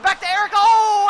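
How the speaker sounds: rising to a loud, high cry; high, loud burst of excitement; excited shout. Real, not acted. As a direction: shout as the scoring chance builds. Excited as chance develops.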